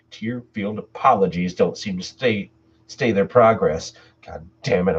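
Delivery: ordinary delivery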